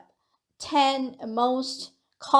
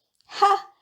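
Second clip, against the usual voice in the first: short loud "哈" pitched up; a touch of surprise in one raised burst; 8 semitones higher, 5 dB louder than usual. Shown as a demonstration. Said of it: "express pride or surprise".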